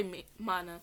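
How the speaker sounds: ordinary delivery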